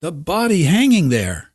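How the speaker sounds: high, loud, stricken cry; horrified. A narrator's reading. Ed crying out to the crowd in horror. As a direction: push it out in horror.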